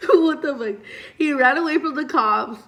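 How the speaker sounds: high, loud incredulous exclaim; amused disbelief. Real, not acted. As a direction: laugh out the disbelief. Laughing disbelief at twist.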